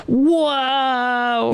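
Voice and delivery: high, stretched-out awed whoa; amazed whoa. Retold or imitated. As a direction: gasp of wonder at the screen. Amazed first viewing reaction.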